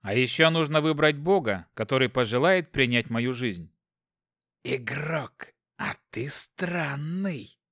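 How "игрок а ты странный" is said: soft, slow sly demon voice; demonic whisper. Acted. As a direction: purr it like a scheming demon. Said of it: "вновь раздался голос демона".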